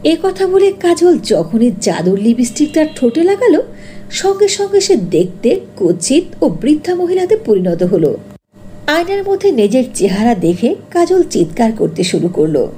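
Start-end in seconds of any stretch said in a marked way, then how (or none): none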